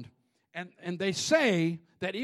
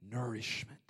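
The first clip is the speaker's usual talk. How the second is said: hushed; 9 semitones lower, 9 dB quieter than usual. Acted. Preacher drops to hushed tone.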